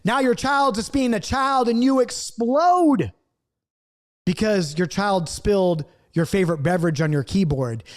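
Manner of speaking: normal talking voice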